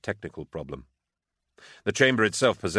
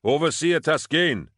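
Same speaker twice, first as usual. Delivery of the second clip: calling out; 9 semitones higher, 5 dB louder than usual. A narrator's reading. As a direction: shout for someone lost in the dark. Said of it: "he called".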